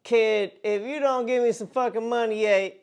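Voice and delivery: mock threat. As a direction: play an angry debt collector giving an ultimatum. Prank caller makes a fake threat.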